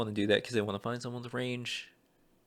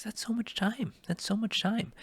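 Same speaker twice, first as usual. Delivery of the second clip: much higher, small high voice; high-pitched aside; 12 semitones higher, 4 dB quieter than usual. Real, not acted. Actor reading a far-off deadline, high-pitched and soft. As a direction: squeak it softly in a high register.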